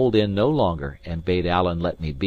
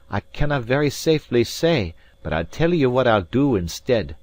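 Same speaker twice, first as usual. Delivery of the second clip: higher-pitched, lively Scots character voice; Alan, canny; 6 semitones higher, 4 dB louder than usual. A narrator's reading. Alan hints at a secret to the maid, canny. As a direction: confide it in a raised Scots voice.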